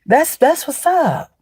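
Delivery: high, mock-impressed wow; sarcastic praise. Sarcastically praising someone playing tricks. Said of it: "you're like".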